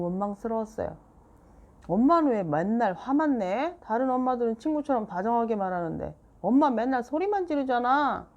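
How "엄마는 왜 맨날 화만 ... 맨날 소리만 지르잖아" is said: put-on higher, louder resentful child; resentful complaint. A narrator's reading. Seoyeon complaining to mom, resentful and loud. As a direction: accuse mom of always yelling.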